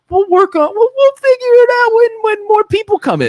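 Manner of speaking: very high-pitched mocking impression; imitating Joe. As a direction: mimic someone in a squeaky put-on voice. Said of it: "as Joe said to us".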